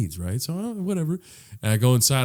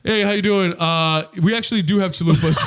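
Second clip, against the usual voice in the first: louder, higher, over-polite server; employee voice; 5 semitones higher, 6 dB louder than usual. Retold or imitated. Employee correcting the message for the customer, upbeat. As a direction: cut in brightly over the intercom.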